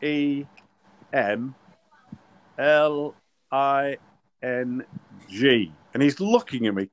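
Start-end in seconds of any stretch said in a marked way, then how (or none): 0.0-5.7 letter by letter with long pauses; spelling out. Retold or imitated. Referee booking the England captain, spelling deliberately. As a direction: sound out each letter separately, deliberately. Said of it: "spelling it out, by the way, sounding it out".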